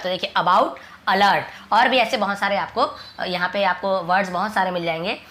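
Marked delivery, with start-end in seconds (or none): none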